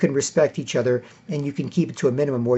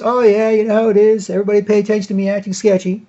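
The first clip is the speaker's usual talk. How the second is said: high, loud mocking voice of an attention seeker; mock a show-off hogging the table; 6 semitones higher, 5 dB louder than usual. Mocking a rude guest at the table, high loud mock voice. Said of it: "somebody starts".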